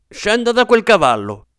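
a high, loud order barked in fury; angry command. A narrator's reading. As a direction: order him off the horse, outraged. Benjamin commands the colonel angrily. (ordine furioso e acuto)

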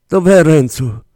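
high, loud anxious question; anguished. Acted. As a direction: fear for him breaking through. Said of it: "domandò Erika angosciata". (domanda angosciata)

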